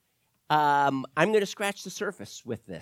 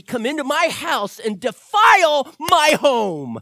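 louder mock-outraged indignation, building; mock anger; 4 semitones higher, 6 dB louder than usual. Preacher hamming up his anger at the roach, louder and building. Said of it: "is anger".

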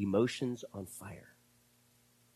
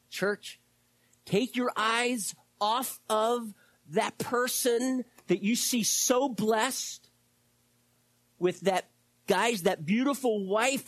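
preaching at full force; 9 semitones higher, 4 dB louder than usual. Real, not acted. Pastor urging the church to stop comparing, preaching. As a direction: exhort the church passionately.

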